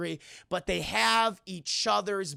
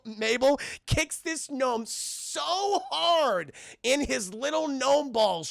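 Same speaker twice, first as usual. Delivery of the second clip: ordinary delivery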